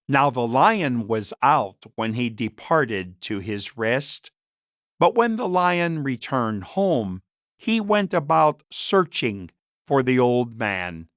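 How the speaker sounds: ordinary delivery, nothing marked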